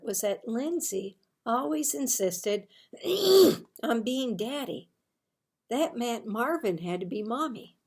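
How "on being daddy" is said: plain reading voice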